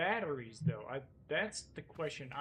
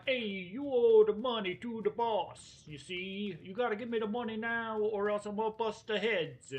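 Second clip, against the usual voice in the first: hammed-up Italian debt collector, higher pitched; Italian accent; 5 semitones higher, 4 dB louder than usual. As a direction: demand the money in a put-on Italian accent. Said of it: "That's my best Italian accent".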